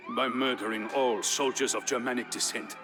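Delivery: ordinary delivery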